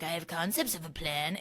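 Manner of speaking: put-on voice of an evasive politician; Donald Trump. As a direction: toss off a mock politician's line, quietly. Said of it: "it's like giving Donald Trump".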